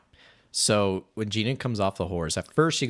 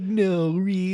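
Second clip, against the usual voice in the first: higher-pitched childlike imitation; 9 semitones higher, slower than usual. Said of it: "leaning into the childlike personality".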